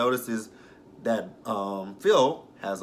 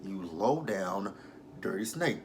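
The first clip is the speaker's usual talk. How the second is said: scornful aside; 7 dB quieter, slower than usual. Real, not acted. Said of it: "I'm like".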